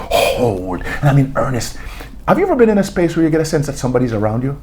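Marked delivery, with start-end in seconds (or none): 0.1-0.8 low, slow theatrical recitation of Lady Macbeth; low, unhurried Shakespeare monologue, whispered near the end; reciting Shakespeare. Retold or imitated. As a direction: declaim it slowly and darkly, like a stage soliloquy. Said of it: "I began to recite Shakespeare to myself".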